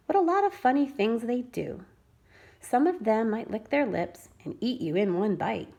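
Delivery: usual speaking voice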